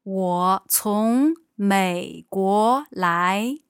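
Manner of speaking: ordinary talk, nothing marked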